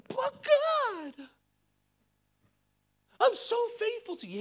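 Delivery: high-pitched, put-on pleading; mock whiny protest. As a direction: whine up at God in a hammed-up voice. Acts believer protesting to God.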